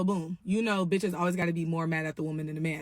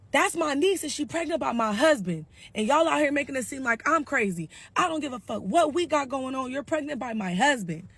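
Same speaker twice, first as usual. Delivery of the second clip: angry rant; 6 semitones higher than usual. Retold or imitated. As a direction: rant at the whole family. Sarah ranting to family.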